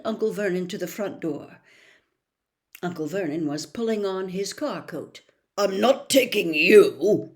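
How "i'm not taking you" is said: slow loud snarl. A narrator's reading. Uncle snarling at Harry by the door, loud. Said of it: "he snarled".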